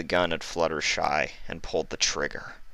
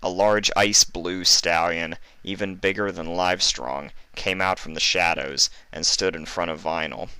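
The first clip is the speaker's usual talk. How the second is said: ordinary delivery, nothing marked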